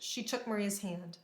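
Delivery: ordinary delivery, nothing marked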